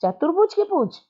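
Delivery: high, mocking ask; teasing question. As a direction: poke fun at the tail's owner. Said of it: "बोलता है".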